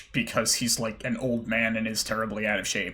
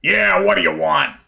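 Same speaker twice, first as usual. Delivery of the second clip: gruff shopkeeper; 5 semitones higher, 8 dB louder than usual. Acted. Shopkeeper NPC greets gruffly.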